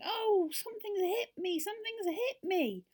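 very high, loud, slow wail; shrieky drawn-out complaint; shouting in alarm. A narrator's reading. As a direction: cry out like an elf who's been struck.

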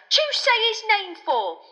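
very high, drawn-out loud yelp; alarmed yelp. A narrator's reading. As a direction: scold in a panicked squeal. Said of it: "yelped Stan".

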